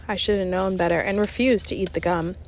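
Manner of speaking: ordinary delivery, nothing marked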